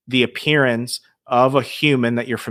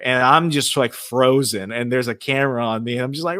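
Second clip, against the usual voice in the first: normal talking voice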